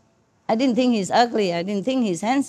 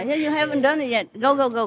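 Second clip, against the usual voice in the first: ordinary talk, nothing marked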